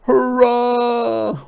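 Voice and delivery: loud, high squeaky hurrah; joyful hedgehog shout; hedgehog cheer. Acted. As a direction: cheer it out in relief. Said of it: "cried Hedgehog".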